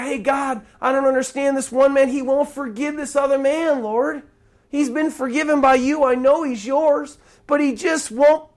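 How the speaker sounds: high, urgent acted appeal to God; earnest prayerful complaint, pitched high; bewildered plea.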